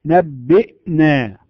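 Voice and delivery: نبئنا slow, the hamza cut off sharp; stopped hamza. Shown as a demonstration. Teacher demonstrating hamza in نبئنا, slowly. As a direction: hold the breath hard on the hamza of نبئنا. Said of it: "نقول".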